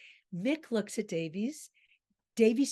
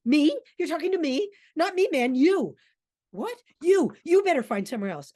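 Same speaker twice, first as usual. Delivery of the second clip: loud, high-pitched comeback; indignant retort; 6 semitones higher, 8 dB louder, faster than usual. Acted. Trapped Davies turns Aston's words back on him, indignant. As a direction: throw it straight back, loud and affronted.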